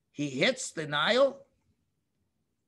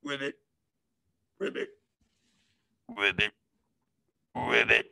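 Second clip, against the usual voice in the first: frog croak; 9 semitones lower than usual. Acted. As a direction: croak gently like a little frog. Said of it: "A nice little frog goes".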